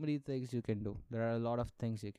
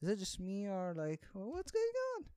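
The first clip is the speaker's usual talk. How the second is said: much higher, squeaky flustered voice; imagined swooning self; 11 semitones higher, 4 dB quieter than usual. Pretend. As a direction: ask breathlessly if he feels it too. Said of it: "and I'm like".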